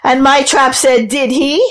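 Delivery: high, out-of-breath fisherman; panting. A narrator's reading. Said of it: "The fisherman panted".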